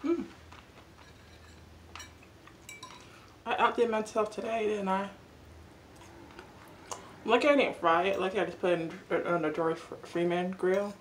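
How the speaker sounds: chewing while talking; speaking between bites; mouth full. Real, not acted. Seen 0: fork goes into mouth at 2.1, chewing food throughout.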